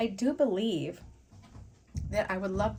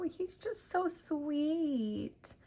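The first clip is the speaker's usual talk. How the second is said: slow, high, softer coo; 7 semitones higher, 4 dB quieter, much slower than usual. Real, not acted. Cooing slowly over the little dog bead. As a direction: melt over something cute.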